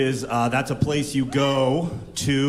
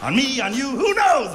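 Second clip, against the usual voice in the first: manic glee; 10 semitones higher than usual. Acted. Manic comic rise.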